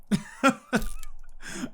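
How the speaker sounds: airy laugh under the breath; a soft wheezy chuckle; breathy silent laugh. Real, not acted. Laughs at own joke.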